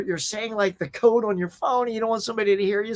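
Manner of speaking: usual speaking voice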